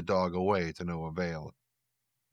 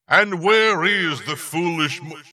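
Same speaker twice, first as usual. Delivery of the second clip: booming demon lord; 9 semitones higher, 9 dB louder than usual. Acted. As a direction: menace her with a rolled R. Demon booms, then breaks off.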